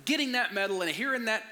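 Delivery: put-on high-pitched, rousing speech to a child; retold fired-up dad speech; motivational pep talk. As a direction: paint the victory like a movie coach. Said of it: "like Rocky, like giving my son a speech".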